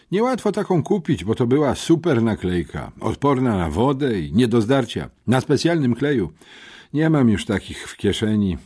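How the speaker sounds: lekko podniesiony głos rzeczowego chłopca; przejęty uczeń. A narrator's reading. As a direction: report it like an earnest schoolboy witness. (przejęty, rzeczowy uczeń)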